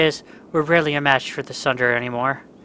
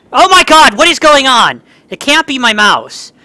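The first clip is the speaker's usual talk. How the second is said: high, loud disbelief, slowing down; real loud frustration over the mouse; exasperated yelling; 9 semitones higher, 13 dB louder than usual. Real, not acted. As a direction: rant at the failing mouse. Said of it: "I'm getting so angry".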